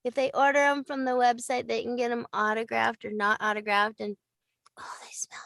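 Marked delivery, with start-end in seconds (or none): 4.8-5.4 hushed, secretive whisper about the candle; whispering over a scented candle; playful whisper. Real, not acted. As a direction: lean in and whisper as if sharing a secret. Showing off her scented candles.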